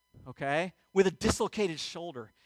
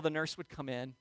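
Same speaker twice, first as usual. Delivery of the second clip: ordinary talk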